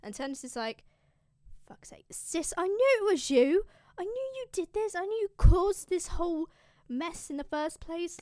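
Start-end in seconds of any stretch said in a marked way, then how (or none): 1.7-8.2 exasperated outburst. Acted. Turnus angry at his disguised sister.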